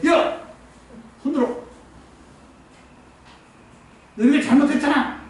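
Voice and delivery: 꾸짖기. Retold or imitated. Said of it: "이 꾸짖는 거예요".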